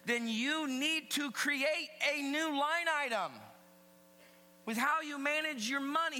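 high, loud sermon voice; emphatic preaching. Real, not acted. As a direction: preach it to the whole room with punch. Preaches at full voice.

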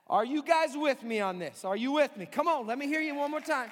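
loud rallying call to the crowd. Real, not acted. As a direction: pump up the congregation. Pastor rallies the congregation.

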